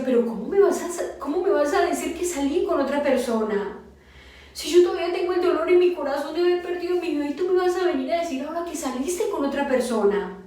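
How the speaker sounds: high, indignant protest; retold outraged reproach, pitch jumping up and speeding along; hurt confrontation. Grieving wife confronting her unfaithful husband, hurt and indignant.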